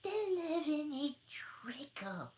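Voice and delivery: slow, high, breathy secret; whisper. Acted. Dormouse answering Alice in a slow whisper. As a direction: hush it like a secret.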